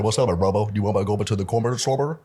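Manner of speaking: quick, playful secret-code talk; made-up kid language. Retold or imitated. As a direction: rattle off a kids' private language. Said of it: "we would put B's in between everything".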